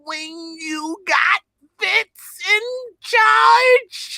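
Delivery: high, slow, strained cry; drawn-out, agonized mock strain; straining power-up. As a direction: build it like powering up to Super Saiyan. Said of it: "trying to go Super Saiyan".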